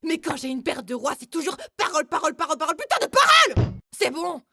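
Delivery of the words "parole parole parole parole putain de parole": from grumbling to a furious yell; building angry rant. Acted. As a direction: let the rant boil over. (colère qui monte jusqu'au cri)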